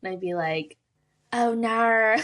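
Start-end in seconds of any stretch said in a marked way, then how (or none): 1.3-2.1 mock Aussie "oh no", louder; Australian accent. Swimmer joking about her biggest fear, mock Australian accent. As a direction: put on an exaggerated Australian accent. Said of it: "say an Australian accent".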